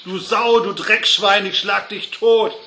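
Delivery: put-on loud, high-pitched drunken-brawl yelling; furious bellow. A narrator's reading. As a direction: bellow the insults at full voice. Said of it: "dann brüllte einer".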